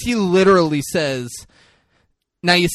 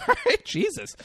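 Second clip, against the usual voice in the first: high, laughing, quieter; laughing reply; 5 dB quieter than usual. Retold or imitated. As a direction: giggle it out, mock exasperated. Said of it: "And she's like".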